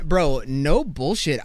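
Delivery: ordinary talk, nothing marked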